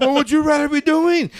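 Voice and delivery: high, mocking officer question; sarcastic officer. Sergeant questioning a soldier, high and sarcastic. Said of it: "his tone was totally sarcastic".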